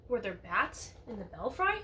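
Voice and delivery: usual speaking voice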